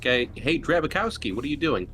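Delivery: usual speaking voice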